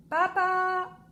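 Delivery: high, loud call for papa; little boy calling papa from bed; child shouting. A narrator's reading. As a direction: yell it across the house. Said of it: "crie Alphonse".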